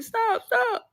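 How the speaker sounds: very high, wailing mock cry; high-pitched imitation of a panicked scream; imitated screaming plea. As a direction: squeal it like someone begging off a beating. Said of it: "he's screaming".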